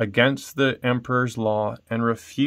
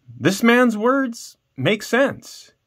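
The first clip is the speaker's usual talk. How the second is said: much higher, slow dawning realization; sudden exclamation; 11 semitones higher, much slower than usual. Acted. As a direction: burst out as the truth hits him.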